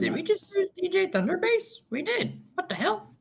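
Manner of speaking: shocked disbelief. Real, not acted. Connection dropped, player panics.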